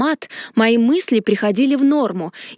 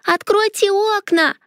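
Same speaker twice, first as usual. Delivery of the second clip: piercing high squeal; shrill screech; 10 semitones higher than usual. A narrator's reading. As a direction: screech it shrilly like a frantic child. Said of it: "пронзительно заверещала Леонари".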